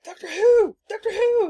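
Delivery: high, loud, gleeful shouting; excited shout. Retold or imitated. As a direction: squeal it out like a thrilled fan. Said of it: "And they're like".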